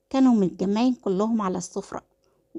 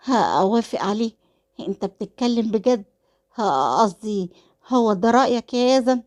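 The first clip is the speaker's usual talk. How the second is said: ordinary delivery, nothing marked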